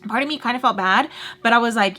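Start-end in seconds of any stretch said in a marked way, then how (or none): none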